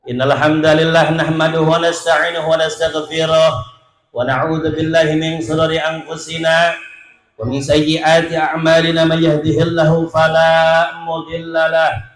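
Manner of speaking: steady chanted Arabic opening, long held notes; Arabic sermon recitation. Acted. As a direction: intone the opening praise like a preacher.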